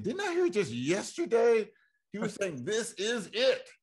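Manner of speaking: high, stunned retold question; retold disbelief. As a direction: ask it as if you can't believe it. Retelling own shocked reaction.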